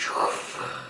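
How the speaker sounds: voiceless rushing whoosh; imitated whoosh. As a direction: imitate colour spreading through the water. Imitates pool filling with colour.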